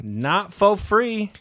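slow, stretched for effect; slow drawn-out. Acted. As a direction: drag out every word. Repeating the joke as a punchline.

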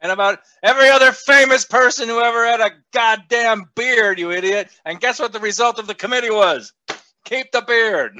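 triumphant put-down; defiant rant. Real, not acted.

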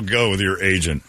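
ordinary delivery

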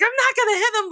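high-pitched retold protest; imitated worked-up protest; agitated bandmate. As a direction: insist heatedly, pitch way up. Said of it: "And Cedric's like".

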